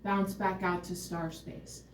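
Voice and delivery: soft murmur back to star space; hushed refrain. Real, not acted. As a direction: drift it out gently. Refrain of the regression.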